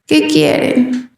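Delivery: slow, drowsy reply in her own voice. Retold or imitated. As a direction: mimic the ghost sounding like her, slow and sleepy. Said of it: "Pero con mi voz". (imitando su propia voz, lenta y adormilada)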